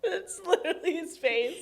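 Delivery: high laughing squeal. Real, not acted. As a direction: cackle at the face.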